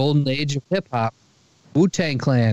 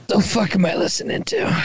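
higher-pitched retold disbelief; mock incredulous; 6 semitones higher than usual. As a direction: act out baffled annoyance at the radio. Retelling his reaction to radio.

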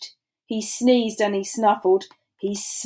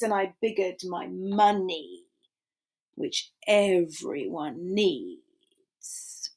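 slow drop; 3 semitones lower, 7 dB quieter, slower than usual. Acted. Line slowed and dropped.

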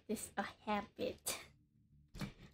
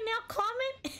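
laughing; 12 semitones higher than usual. Real, not acted. Laughing at chat comment.

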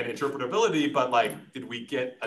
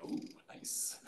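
an airy, admiring whisper of ooh nice; whispered impressed ooh; 16 dB quieter than usual. Retold or imitated. Eyeing a nice coat in the shop, whispered admiration. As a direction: breathe it out like you've spotted something you want. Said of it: "I was like".

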